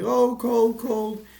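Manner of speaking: high, loud yelp at icy water; jolted shriek from cold water; yelling cold. Retold or imitated. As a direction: yelp as if jumping into freezing water. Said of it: "He starts yelling".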